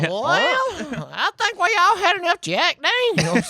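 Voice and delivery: very high, slow, exaggerated Southern drawl; hammed-up placating guide, stretched and high; tour guide voice. Tour guide calming drinkers, high drawn-out put-on voice. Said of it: "that voice is".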